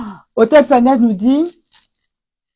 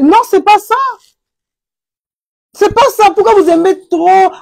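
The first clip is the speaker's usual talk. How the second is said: high, loud no, that's not it; firmly shouting them down; emphatic shout; 10 semitones higher, 3 dB louder than usual. Real, not acted. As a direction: shout the correction. Scolding correction.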